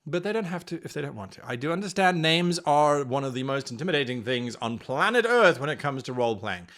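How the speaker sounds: ordinary talk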